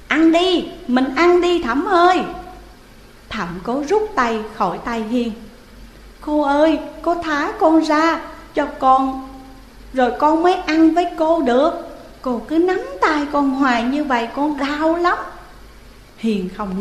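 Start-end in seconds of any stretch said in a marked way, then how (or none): 0.1-2.2 high, loud playful coaxing; ghost girl coaxing. Acted. Ghost Hiền urging Thẩm to eat, high and eager. 6.2-15.2 put-on high, childlike pleading voice; pleading child. Young Thẩm begging ghost Hiền to let go, high childlike plea.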